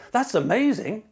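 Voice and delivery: bright, high and genuinely delighted; excited. Shown as a demonstration. Host demonstrating a sincere delivery: truly thrilled. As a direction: pitch it up and sound truly thrilled. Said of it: "I could say now".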